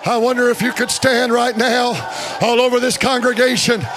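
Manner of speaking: urgent call building to a high, loud shout; rally the congregation, driving each plea harder; preacher crying out to God, steadily rising; building revival preaching. Real, not acted.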